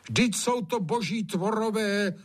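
put-on high-pitched pleading old gamekeeper; horrified servant. A narrator's reading. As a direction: plead earnestly for the poor creatures. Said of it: "zhrozil se Hajný".

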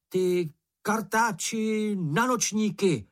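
high, slow hammed-up taunt; hollering insults. A narrator's reading. Mumínek keeps provoking the bush, high hollered taunt. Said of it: "zahulákal Mumínek".

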